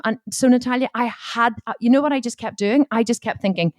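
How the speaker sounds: ordinary talk, nothing marked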